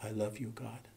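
very soft murmured prayer; under her breath. Retold or imitated. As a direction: imitate a dying woman's faint whisper. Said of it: "heard her whisper under her breath".

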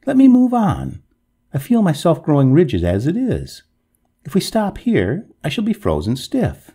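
high, urgent plea; pleading cry. A narrator's reading. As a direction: protest in alarm, starting loud. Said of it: "cried the ghost".

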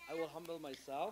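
very soft, low retold reply; humbled reply. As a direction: say it small and meek, barely voiced. Humbled Naaman's reply, for repeating.

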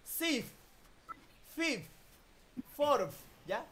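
teacher drilling final F sounds; F ending demo. Shown as a demonstration. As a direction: close the lips into an F at the end of each. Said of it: "termina en F".

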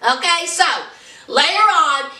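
ordinary talk, nothing marked

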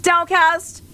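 high, rising incredulous question; scandalized disbelief. Real, not acted. Streamer learning who sent the jokes, scandalized. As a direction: pitch it up as if betrayed.

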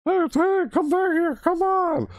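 very high-pitched pleading shout; squeaky creature call. Acted. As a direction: call after someone in a tiny squeaky voice. Voices a creature calling after someone.

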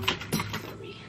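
whispered apology "Sorry.". Real, not acted. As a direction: breathe "Sorry." with no voice. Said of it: "Sorry, y'all".

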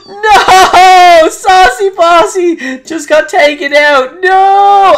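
loud, high cry of disbelief; shocked outcry. Real, not acted. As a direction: yell as your fighter falls. His Pokemon gets knocked out.

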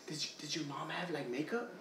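ordinary delivery

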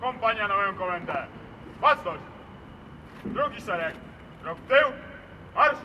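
shouted salute orders; high ringing parade commands; military command. Real, not acted. (wykrzykiwane komendy salwy)

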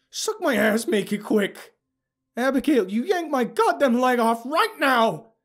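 high, loud enraged yelling; furious demand. A narrator's reading. Wounded narrator orders Abigail to take his leg, yelling furiously. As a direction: scream the order at her. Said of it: "I demanded again".

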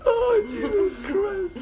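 slow, high, laughing through tears; crying with laughter. Real, not acted. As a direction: gasp it out mid laughter. Helpless laughter fit.

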